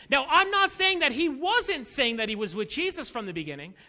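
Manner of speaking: ordinary delivery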